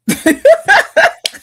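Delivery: squealing self-mocking laugh; high laugh. Real, not acted. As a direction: burst out laughing at yourself. Laughs at own mistake.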